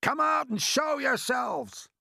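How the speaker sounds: loud, high, drawn-out command; shouted order. A narrator's reading. Police chief ordering hidden strangers out, shouting. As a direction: holler the order up to them. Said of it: "shouted the chief of police".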